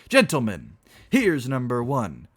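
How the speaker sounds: high, loud riddle call; loud announcement. Acted. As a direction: proclaim it slowly to a noisy room. Reveller announces first riddle.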